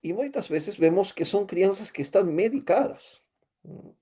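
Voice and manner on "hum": usual speaking voice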